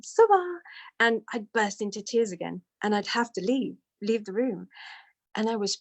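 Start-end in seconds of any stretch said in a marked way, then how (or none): 0.0-0.6 much higher, louder call of a name; imitated name call. Guru calling to her across breakfast, high and louder.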